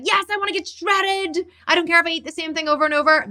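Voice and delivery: high-pitched mock enthusiasm; eager followers. Everyone wanting to get shredded, mimicked high and eager. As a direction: gush it high, imitating keen dieters. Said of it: "Everyone's like".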